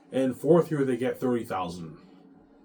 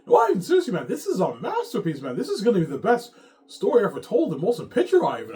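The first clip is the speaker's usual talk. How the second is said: overexcited Peter; 9 semitones higher, 6 dB louder than usual. Retold or imitated. Ivan voicing overexcited Peter.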